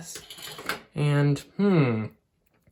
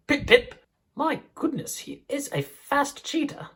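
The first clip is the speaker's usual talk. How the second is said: put-on high, bright British accent puppet penguin, much higher; chirpy British-accented penguin introduces herself; 10 semitones higher than usual. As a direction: [name] greets the class in a high British accent. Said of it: "made both of you with British accents".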